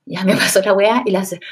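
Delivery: ordinary talk, nothing marked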